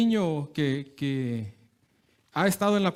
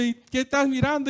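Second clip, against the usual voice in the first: higher, hammed-up bully challenge; mock schoolyard threat, pitched up; mock tough challenge; 7 semitones higher, 3 dB louder than usual. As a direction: play a playground tough picking a fight, half-joking. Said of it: "Y muy bravito y muy acá".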